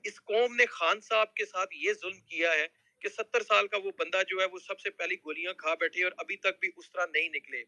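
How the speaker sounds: normal talking voice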